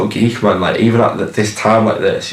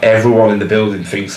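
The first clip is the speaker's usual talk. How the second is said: ordinary delivery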